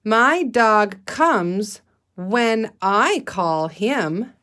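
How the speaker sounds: slow, word by word choral reading; slow read-aloud. Shown as a demonstration. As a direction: read it slowly together. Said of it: "Let's read that sentence together".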